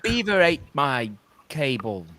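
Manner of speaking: put-on a slow, clumsy Australian accent; bad Australian accent. Joking about beavers, a slow bad Australian accent. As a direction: botch an Australian accent on purpose. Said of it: "that was the worst Canadian accent".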